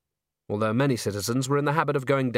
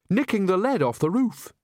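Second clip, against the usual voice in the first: cheerful, much higher character voice; happy; 9 semitones higher than usual. A narrator's reading. Nanny telling Granny what Nev was doing, quite happily. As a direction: say it with blithe pride. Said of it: "Nanny said this quite happily".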